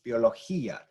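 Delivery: "biología" as bio-lo-HEE-ah, stress on the í; separated vowels. Shown as a demonstration. Teacher gives the word as it is, "biología" with split vowels. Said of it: "have E and uh as separate sounds".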